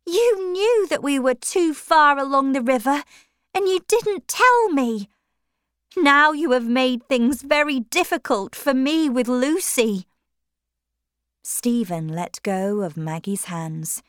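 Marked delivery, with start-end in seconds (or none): 0.0-10.1 angry reproach. Acted. Maggie rebukes Stephen.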